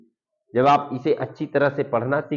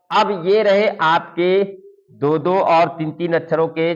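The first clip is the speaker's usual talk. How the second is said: ordinary talk, nothing marked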